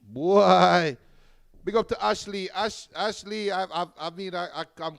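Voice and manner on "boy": ordinary delivery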